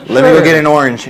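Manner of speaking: chuckling while answering; laughing. Retold or imitated. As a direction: laugh it off lightly. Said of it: "I was like".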